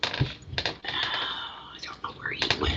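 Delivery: breathy whispered aside. Real, not acted. Reader whispering about her missing dog. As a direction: whisper it so no one hears.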